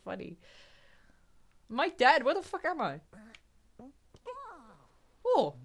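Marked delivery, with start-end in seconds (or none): none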